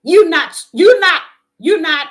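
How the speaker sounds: loud, punched rebuke. Real, not acted. Preacher loudly rebuking the men who doubt her. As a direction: hammer each you not at them.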